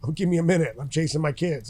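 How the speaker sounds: loud, exasperated retort, acted out; indignant protest. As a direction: push back loudly at an intrusion, replaying it. Acting out protest to rude fan.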